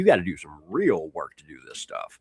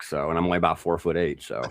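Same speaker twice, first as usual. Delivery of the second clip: usual speaking voice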